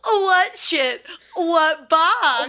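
high, loud, hammed-up bewilderment; feigned innocence. Spy asks guard what box he means, feigned innocence. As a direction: play dumb in a mock-baffled cry. Spy playing dumb, mock denial.

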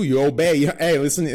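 ordinary talk, nothing marked

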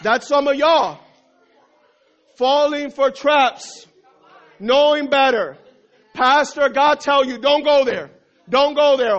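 loud, high preaching; preacher at full force. Acted. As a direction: drive the point home to the church.

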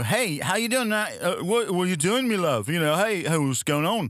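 British accent, Liverpool lilt. Shown as a demonstration. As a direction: put on a chummy British accent. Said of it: "Is that like a [name]?".

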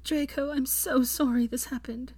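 put-on grieving young woman apologizing; Hermione, tearful. A narrator's reading.

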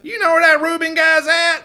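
high, loud yell. Acted. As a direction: yell across the fairground. Yelling across the carnival.

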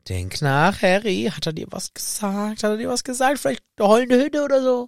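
high-pitched, rising urgent questions; coaxing prodding. Acted. Friend urging Harry to remember the hiding place, acted coaxing.

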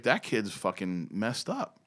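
slow, low, soft; quiet retold aside, drawn out; deadpan verdict. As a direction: murmur it as a dry judgement. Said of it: "So I was just like".

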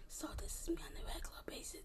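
barely voiced whisper; breathy near-silent murmur of the quiet girl; imitating her low voice. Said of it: "her voice was like".

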